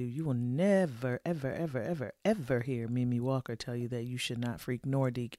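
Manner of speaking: every "ever" stretched and hit in turn; stress on ever. Real, not acted. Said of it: "emphatic nevers".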